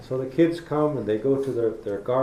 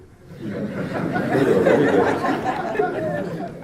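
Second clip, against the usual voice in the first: big laugh; 7 dB louder than usual. Real, not acted. Speaker laughing at her own joke, loud high laugh.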